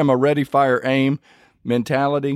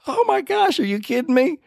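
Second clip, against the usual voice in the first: much higher, incredulous cry; stunned disbelief; 13 semitones higher than usual. Retold or imitated. Retelling his reaction to God's answer, high and incredulous. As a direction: squeak it out in amazed disbelief. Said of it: "I was like".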